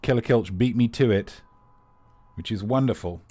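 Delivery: ordinary talk, nothing marked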